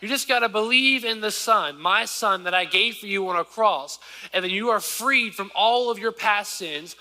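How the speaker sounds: high, loud preacher voicing God; God speaking through the preacher; preaching. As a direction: lift the congregation as God's promise.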